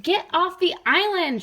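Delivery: high, loud shout; bossy frog yell. Acted. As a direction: shout him off the island. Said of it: "shouted Rupert".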